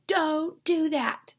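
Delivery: high, loud, slow angry command; angry exclamation. Acted. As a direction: shout it as a firm warning. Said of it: "They're really angry".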